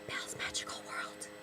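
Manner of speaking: barely audible, voiceless whisper; hushed whisper. Acted. Recalling terrible sequels for viewers, hushed whisper. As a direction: whisper it like an unmentionable title.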